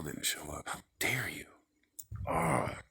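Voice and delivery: hushed mock-offended whisper. Real, not acted. Scolding the absent person in a whisper. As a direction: breathe the words out.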